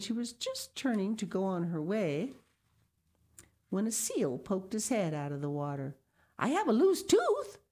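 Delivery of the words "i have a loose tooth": put-on high, loud little girl's voice; little girl announcing. A narrator's reading. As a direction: tell the seal eagerly like a child. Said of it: "Sal said to the seal".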